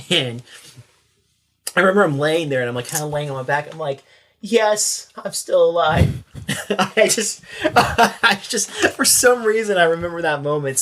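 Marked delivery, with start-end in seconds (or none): 4.4-6.2 high, drawn-out mock-exasperated answer; exasperated retold reply. Officer on his back answering whether he's alive, mock-exasperated. 6.5-9.8 high, loud, laughing through the words; real laughing. Real, not acted. Storyteller cracking up at his own memory, laughing.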